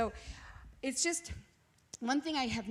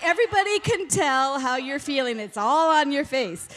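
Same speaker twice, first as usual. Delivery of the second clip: quoting husband; 3 semitones higher, 10 dB louder than usual. Retold or imitated. Husband quoted in her story.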